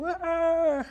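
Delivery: high, mock toddler shriek; toddler scream imitation. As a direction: squeal like a child running off. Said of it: "that's the same reaction".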